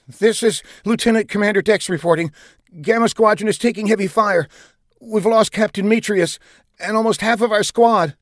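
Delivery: high, urgent distress report; frantic. A narrator's reading. Lieutenant commander reporting losses over radio, frantic. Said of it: "a frantic voice came over".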